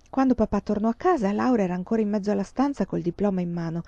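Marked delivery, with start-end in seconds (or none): none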